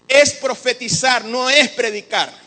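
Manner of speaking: loud, deliberate preaching emphasis; forceful emphasis. Real, not acted. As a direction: hammer out each word of the contrast. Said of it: "emphatic teaching point".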